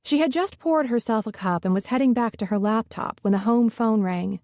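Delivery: ordinary delivery, nothing marked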